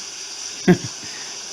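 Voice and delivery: soft breathy laugh under the breath; a quiet rueful laugh. Acted. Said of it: "He laughed quietly then".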